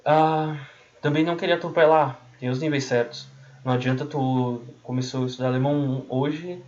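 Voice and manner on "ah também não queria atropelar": usual speaking voice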